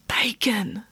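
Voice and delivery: whispered forbidden word; whispering. A narrator's reading. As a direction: breathe it like a dirty secret.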